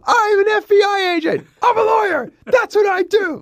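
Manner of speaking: mock shouting pitch. Director hamming up pitches to Keanu, high shout. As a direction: shriek the jobs like a cartoon. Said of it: "we were there with him going".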